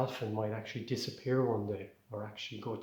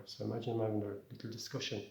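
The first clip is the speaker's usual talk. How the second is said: ordinary talk, nothing marked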